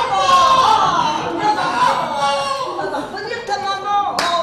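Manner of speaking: loud, high comic wail; mock outcry. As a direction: cry out for mum, hammed up. Acted outburst after calls of maman. (cri exagéré)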